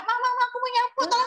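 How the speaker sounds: put-on high, pleading little kid begging mama; child voice. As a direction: ask like a small child wanting to help. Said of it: "Nanti minta".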